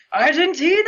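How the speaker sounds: high, excited shout-along; crowd-style yell. Retold or imitated. Fan recalls the live track's shout, yelling it high. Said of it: "yelling out".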